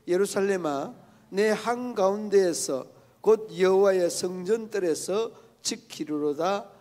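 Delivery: slow, loud, solemn reading of the verse; loud scripture reading. Shown as a demonstration. Pastor leads the congregation reading the psalm, slow and loud. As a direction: read it out slowly for the congregation to join. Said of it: "함께 한번 읽어봅니다".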